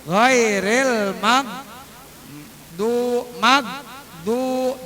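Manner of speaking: slow "magdu" with "mag" running straight into "du"; no bounce on consonant. Shown as a demonstration. Teacher models the reading, "magdu" slowly with no bounce. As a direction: chant "magdu" without an added vowel. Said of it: "Jangan ditahan, magdu".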